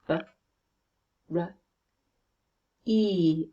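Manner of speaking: B, r, e sounded one by one; short B, short r, then long e as in see; B... r... e, with wide pauses; sounds in isolation. Shown as a demonstration. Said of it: "First syllable".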